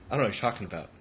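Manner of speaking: low, soft mock innocence; playing it off. Friend denying the neck flick to a drunk man, playing it off. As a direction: brush it off quietly, acting clueless. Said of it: "she plays it off".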